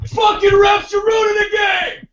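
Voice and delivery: high, loud furious yell at refs; angry fan shout. Retold or imitated. Guy behind me screams at the refs. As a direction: bellow at the refs like a sore fan. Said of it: "have that guy behind me".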